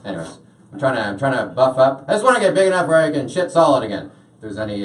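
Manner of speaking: ordinary talk, nothing marked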